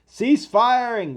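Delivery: drawn-out, high, loud battle order; shouted command. Acted. Officer calling a ceasefire to troops, loud and slow. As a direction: bellow the order across the field.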